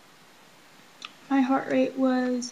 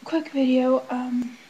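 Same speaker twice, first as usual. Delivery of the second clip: ordinary delivery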